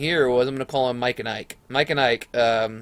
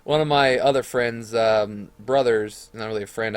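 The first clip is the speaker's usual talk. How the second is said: usual speaking voice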